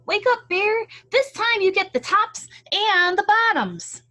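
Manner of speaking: high, loud cheerful call. Acted. Hare waking Bear for the harvest, high loud call. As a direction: shout him awake across the field. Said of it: "Hare called out".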